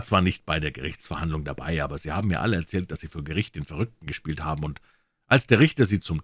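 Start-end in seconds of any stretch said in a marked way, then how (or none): none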